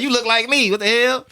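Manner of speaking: high and loud, incredulous; hammed-up high exclamation; mock amazement. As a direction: squeal it, stunned by the likeness. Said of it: "He's like".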